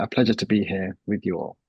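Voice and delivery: ordinary delivery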